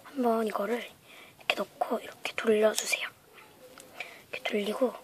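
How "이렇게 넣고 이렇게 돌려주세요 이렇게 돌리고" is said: whispering. Real, not acted. Whispered instruction.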